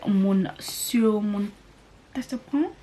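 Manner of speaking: slow, careful stab at a French accent; unsure French accent, drawn out; attempted French accent. Shown as a demonstration. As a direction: try the name in an amateur French accent. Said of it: "Is my French accent good?".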